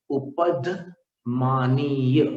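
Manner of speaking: उपधमानीय stretched very slowly syllable by syllable; drawn out term. Shown as a demonstration. Teacher to students, slowly drawing out the term. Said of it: "इसको उपधमानीय कहते हैं".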